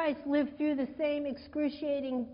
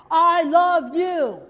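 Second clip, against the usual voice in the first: loud, slow, shouted I love you; all caps; 9 dB louder, slower than usual. Shown as a demonstration. Preacher showing the congregation an all-caps text, shouting. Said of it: "you know, all caps".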